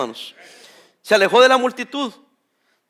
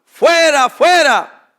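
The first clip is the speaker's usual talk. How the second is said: loud, higher shout of an angry crowd; 4 semitones higher, 6 dB louder, much slower than usual. A narrator's reading. The crowd shouting at Pilate to take Jesus away, loud angry shout. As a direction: cry it out like a mob demanding. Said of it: "Pero ellos gritaron".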